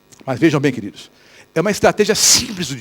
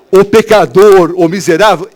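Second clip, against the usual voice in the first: louder and slow, stern rebuke; convicting voice; 7 dB louder, slower than usual. Acted. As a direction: call out the sinner slowly and firmly. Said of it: "é a voz do Espírito Santo". (voz do Espírito Santo, alta e solene)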